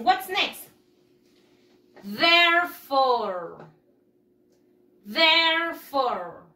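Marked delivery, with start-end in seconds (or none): 2.0-3.6 Therefore slow and loud, THER-fore; slow model word. Shown as a demonstration. Teacher modeling pronunciation for pupils, slow. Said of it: "¿Cómo se pronuncia?". 5.1-6.4 Therefore slow and loud, THER-fore; slow model word. Shown as a demonstration. Teacher modeling pronunciation for pupils, slow. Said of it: "¿Cómo se pronuncia?".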